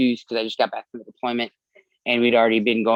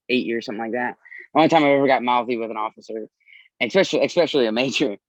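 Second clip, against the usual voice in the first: ordinary talk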